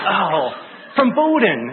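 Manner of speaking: realizing who he is; sudden recognition. Retold or imitated.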